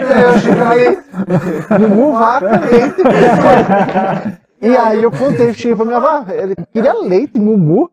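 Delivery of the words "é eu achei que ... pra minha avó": laughing through the words; high, giggly laughing. Real, not acted. Laughing while telling the joke.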